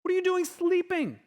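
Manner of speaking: high-pitched incredulous demand; indignant disciples. Acted. Disciples finding Jesus asleep in the storm, high incredulous outburst. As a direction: demand an answer, voice pitched right up. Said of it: "That's exactly what they say".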